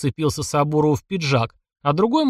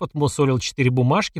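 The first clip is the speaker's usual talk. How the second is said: ordinary delivery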